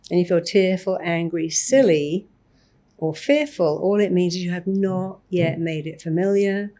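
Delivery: normal talking voice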